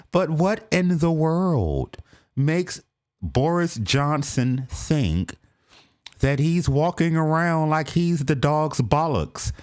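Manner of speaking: sassy American twang on the question; mock American accent, indignant. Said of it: "I'm gonna say it in an American accent".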